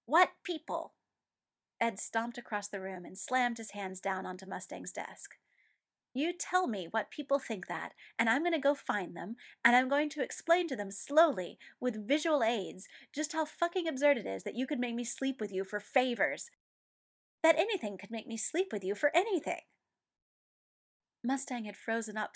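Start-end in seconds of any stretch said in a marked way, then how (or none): 0.1-0.9 loud and higher, insistent; demanding. A narrator's reading. Ed angrily repeating his demand to Mustang. 6.1-19.6 loud, building tirade; furious rant. A narrator's reading. Ed ranting at Mustang over the absurd rumor.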